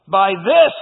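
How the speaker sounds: loud, emphatic cry lifting on THIS; insistent, loud declaration. Acted. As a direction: punch out THIS with conviction. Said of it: "she cries out to Elijah".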